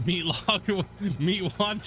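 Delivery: said through laughter; laughing. Real, not acted. Streamer laughing at his botched impression, amused and laughing. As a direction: crack up between the words.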